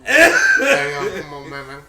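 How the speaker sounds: fast mumbled Texas drawl; Boomhauer impression. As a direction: rattle it off like Boomhauer. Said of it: "You sound like Boomhauer".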